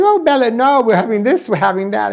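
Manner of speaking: high, loud pleading host voice; imitating the eager host. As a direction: beg her to stay in a high, loud put-on voice. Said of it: "He goes".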